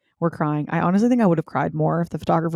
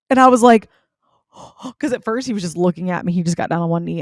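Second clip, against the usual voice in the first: ordinary talk, nothing marked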